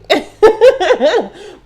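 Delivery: loud high burst of laughter. Real, not acted.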